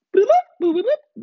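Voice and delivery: very high "boop" beeps, like a censor tone; squeaky mock beeping. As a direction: beep out the last words in a squeaky voice. Mock beeping to cancel remarks.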